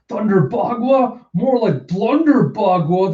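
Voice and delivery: high, slow, scornful mockery; mocking. Sister belittling Yamato's attack, mocking. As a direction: draw out the jeer, pitched high.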